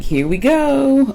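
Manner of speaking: drawn-out, high, knowing sigh of here we go; weary mock exasperation; exasperated. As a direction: roll your eyes at the same old story. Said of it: "And I'm like".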